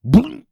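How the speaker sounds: sharp high popping bloop sound. Retold or imitated. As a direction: snap out a cartoon bloop. Imitates snake striking.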